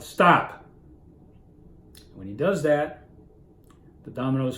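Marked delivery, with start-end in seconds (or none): 0.0-0.6 sudden higher, much louder yell; retold yell. Father snapping at the crying child, sudden yell. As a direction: yell it out in one sharp burst. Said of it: "he yells to the child".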